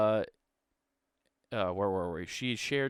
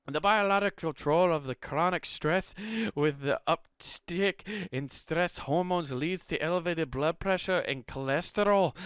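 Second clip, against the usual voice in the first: high, loud, hammed-up Scottish voice; playful Scottish storyteller, pitched up; mock Scottish accent; 8 semitones higher, 4 dB louder than usual. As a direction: read it as an over-the-top Scot. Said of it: "I'll stop with the fucking Scottish accent".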